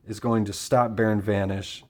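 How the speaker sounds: ordinary delivery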